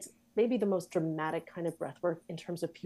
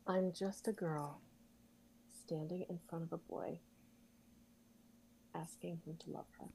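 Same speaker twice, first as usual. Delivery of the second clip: tender, soft delivery; 4 semitones lower, 13 dB quieter than usual. Shown as a demonstration. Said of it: "deliver that line your way".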